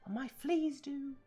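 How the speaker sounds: very high, slow squeaky elf voice; house elf voice. Acted. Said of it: "Why do I sound like a house elf?".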